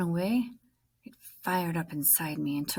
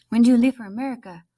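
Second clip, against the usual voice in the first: high, loud, fast question; eager question; 5 semitones higher, 9 dB louder, faster than usual. Acted. Schoolkids pestering Nemo about leaving. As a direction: ask eagerly.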